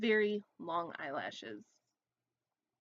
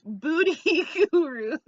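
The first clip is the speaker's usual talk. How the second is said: high laughing booty gurus, much louder; 7 semitones higher, 13 dB louder, slower than usual. Shown as a demonstration. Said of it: "Beauty gurus who might".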